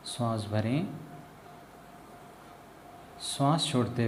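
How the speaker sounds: calm meditation guide, long pauses between phrases; guided meditation. Acted. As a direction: ease them into relaxation, phrase by unhurried phrase. Leads a guided breathing meditation. (ध्यान कराती शांत, ठहरी हुई आवाज़)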